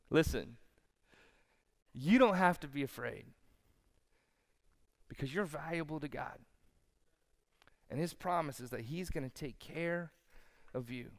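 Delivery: soft, low, with long pauses; hushed pastoral comfort; gentle reassurance. Real, not acted. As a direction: let each phrase settle tenderly. Turns from joke to reassurance.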